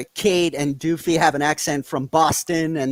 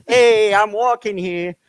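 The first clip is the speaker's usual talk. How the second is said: loud, slow, higher New York holler; mock New York accent; 5 semitones higher, 5 dB louder, slower than usual. Joking with co-host about New York, hammed-up New York accent. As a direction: imitate a New Yorker hollering, drawn out. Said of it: "That's Rick and Morty jokes for you".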